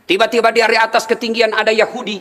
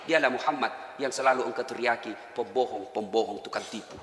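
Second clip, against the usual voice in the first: very soft and low; hushed grief-stricken revelation; tearful reveal; 11 semitones lower, 13 dB quieter than usual. Acted. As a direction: break the news through tears. Abu Bakar reveals with tears.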